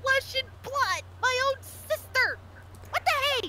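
high, indignant exclamations; mock outrage. Protesting a sister's betrayal, high mock outrage.

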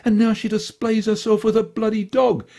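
a pompous dandy grumbling to his dog; Pretty John. A narrator's reading. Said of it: "he muttered to his tiny companion".

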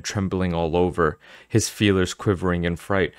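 plain reading voice

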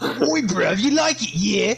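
high, cheeky British lad imitation; mock British accent. Friend replaying his failed Scottish, mock British accent. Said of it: "That's just more British".

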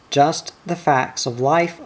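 plain reading voice

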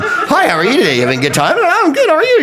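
very high, bubbly mock Disney staff; over-the-top forced niceness; very high saccharine cast-member greeting; gushing theme-park cheer.

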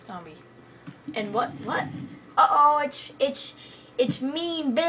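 ordinary delivery

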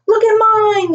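put-on high, excited child's call; yelling out. A narrator's reading. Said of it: "yelled out Chase".

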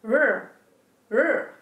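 rrr growled from the back of the throat; guttural rrr from the throat; throaty r. Shown as a demonstration. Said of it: "or you will get this".

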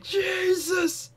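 high exasperated yell; exasperated shout. Real, not acted. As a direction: yell it in disbelief. Frustrated gaming outburst.

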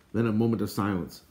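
usual speaking voice

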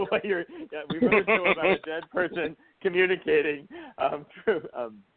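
talking through laughter at the irony; chuckling while explaining; laughing. Real, not acted. [name] amused at the coincidence, laughing through it.

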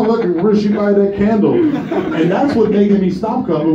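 usual speaking voice

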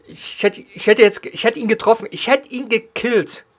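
exasperated rant, slowing down; loud frustrated complaint; man down shout. Real, not acted. Complains about the missed kill.

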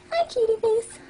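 high-pitched cutesy greeting. Real, not acted. Owner greeting the second pet, baby talk.

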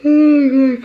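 said through a big yawn. Real, not acted. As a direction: let a yawn stretch the words. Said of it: "I can't stop yawning".

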